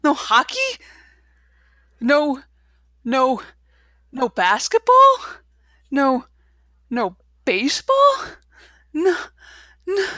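hammed-up shock over lost hockey; mock disbelief. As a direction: act devastated at each canceled sport. Derek learning hockey is canceled.

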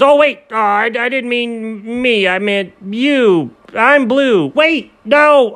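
high, flustered backpedalling; high embarrassed turtle; flustered Noah. Acted. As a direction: pitch up and stumble over the words.

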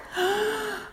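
sharp high gasp before jumping; deep gasp. Acted. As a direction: suck in a big breath. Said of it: "took a deep breath".

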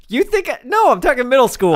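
high, loud insistent correction; loud protest. Real, not acted. Host correcting co-host about high school, loud high protest. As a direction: correct them loudly, playfully indignant.

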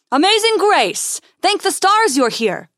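high, loud, relieved welcome; effusive greeting. A narrator's reading. Colony leader welcoming the ranger on the landing pad, effusive. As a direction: hail her loudly, pitched well up.

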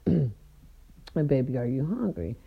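low, slow tender question. Retold or imitated. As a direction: coo it gently to her. Voicing her man, slow low.

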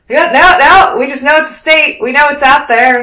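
mock officers. Navy officers answer mockingly.

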